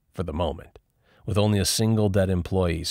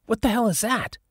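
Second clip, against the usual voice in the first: high, alarmed question; startled. A narrator's reading. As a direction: jump at the sound. Startled by noise.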